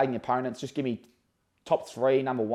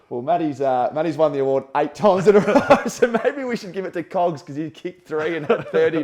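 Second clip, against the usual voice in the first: laughing mock quote; 6 semitones higher, 9 dB louder than usual. Impersonating Matty, laughing.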